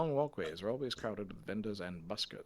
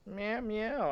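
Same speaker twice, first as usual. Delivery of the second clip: cat meow; 13 semitones higher, 6 dB louder than usual. Retold or imitated. Imitating the cat Salem.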